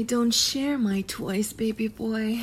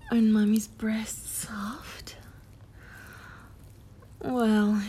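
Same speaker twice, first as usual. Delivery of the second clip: ordinary talk, nothing marked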